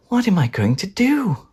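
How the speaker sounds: plain reading voice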